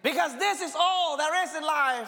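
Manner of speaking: high, loud preacher's cry building through the lines; rising, loud sermon delivery; full-force preaching. Acted. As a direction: preach it out loud to the whole room.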